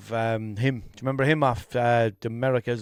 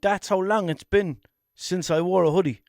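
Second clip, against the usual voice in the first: ordinary talk, nothing marked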